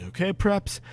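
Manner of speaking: usual speaking voice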